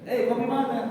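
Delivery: high, loud clipped Papuan speech; clipped Papuan-accented question. Retold or imitated. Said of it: "Kayak orang Papua".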